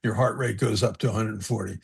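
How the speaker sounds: very proper. Retold or imitated. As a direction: play the staid doctor dryly. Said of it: "very proper".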